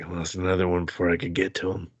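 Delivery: lightly voiced noir detective. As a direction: rasp it like a tired noir cop.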